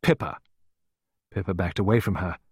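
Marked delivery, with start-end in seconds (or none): 0.0-0.4 short, firm name-call; sharp address. A narrator's reading. Clarissa checking a near-hysterical Pippa, sharp. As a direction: pull the child up sharply. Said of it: "addressed her sharply".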